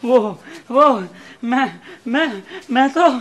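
halting, caught-out hesitation; nervous stammer. Acted. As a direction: falter with pauses, searching for an excuse. Frightened man caught out, stammers.

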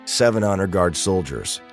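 ordinary talk, nothing marked

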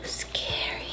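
whispered like a scary secret; spooky whisper. Pretend. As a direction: breathe it out, spooky. Viper snake, spooky.

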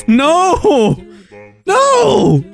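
high-pitched wailing complaint; exasperated dismay. Real, not acted. Player finally got here and can't do anything, exasperated. Game blocks progress at last.

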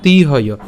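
তিহয় with a long, held ee in তি; lengthened তি in তিহয়, breath at the end; long vowel. Shown as a demonstration. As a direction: stretch তিহয় to tee-hoyoh. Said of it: "উচ্চারণটা কেমন হচ্ছে".

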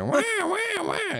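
high screeching wah wah; pterodactyl shriek. Retold or imitated. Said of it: "It's like".

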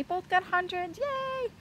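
high little cheer of pride; happy cheer. Real, not acted. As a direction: celebrate the hundreds. Proud of test scores.

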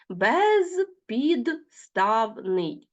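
very slow, each syllable spaced apart. Shown as a demonstration. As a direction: spell out Безпідставний slowly by syllables. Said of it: "teacher splits word into syllables". (повільно, по складах)

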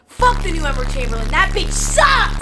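playful furious yell; screaming. Acted. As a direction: scream it at the top of your lungs. Screaming in hotel room.

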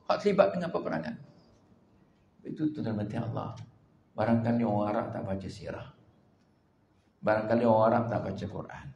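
normal talking voice

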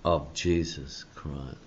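slow, deliberate reading of the verse; a measured pulpit reading from One Peter; slow scripture reading. Acted. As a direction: read it out slowly with weight.

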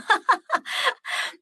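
light burst of high laughter; amused laugh. Real, not acted. Laughs at kids not wanting to go.

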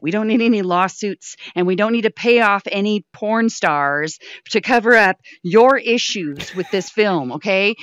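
a hint of a stern boss; mock stern. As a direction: lay down the law like a producer. Mock-stern producer lecture.

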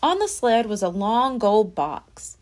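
ordinary delivery

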